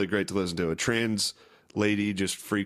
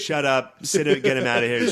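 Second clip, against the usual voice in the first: mock bossy shout; 5 semitones higher, 7 dB louder than usual. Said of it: "She's like".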